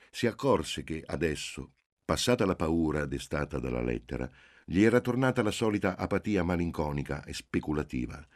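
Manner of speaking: usual speaking voice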